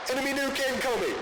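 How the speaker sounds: high, fast mock announcer alert; game announcer imitation. Imitating in-game announcer at trailer's end.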